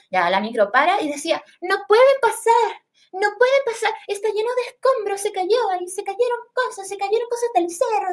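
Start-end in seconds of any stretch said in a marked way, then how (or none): 1.6-8.1 mock upper-class lady warning; posh lady. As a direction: speak very refined. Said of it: "hablaba muy fino".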